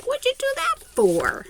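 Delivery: put-on high squeaky voice of an offended girl; indignant girl. Girl in the canoe protesting to the boy, high and indignant. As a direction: pitch way up and protest like she's been wronged. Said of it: "And she's like".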